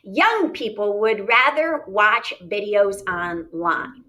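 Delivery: slow, careful read-aloud; measured classroom read-aloud. Shown as a demonstration. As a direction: read each word deliberately to the class. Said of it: "this is my reading voice".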